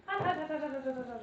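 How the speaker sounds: hot food in mouth; chanting hot through a hot bite; mouth full of hot food. Real, not acted.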